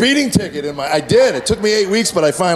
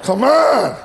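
higher exasperated come on; a higher, fed-up come on; 5 semitones higher than usual. Real, not acted. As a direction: throw up your hands at it. Exasperated punchline.

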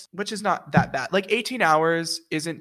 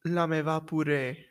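French verse with an Italian-like accent; Italian-sounding French. Shown as a demonstration. Voice student showing a friend some French, Italian-sounding accent. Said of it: "You're saying it with an Italian accent".

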